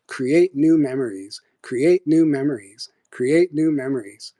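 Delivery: slow, identical looped phrases; repeating subliminal message. Retold or imitated. Message underneath the TV, slow repeated chant. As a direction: chant it slowly like a recording on repeat. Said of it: "message that was being played on repeat over and over".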